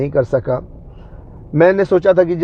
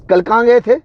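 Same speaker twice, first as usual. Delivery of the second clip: sharp, higher and louder demand; angry question; 7 semitones higher, 4 dB louder than usual. Retold or imitated. Wife angrily asking where he was yesterday, sharp and high. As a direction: snap the question at him like an annoyed wife. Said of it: "मेरे से गुस्सा होके पूछी".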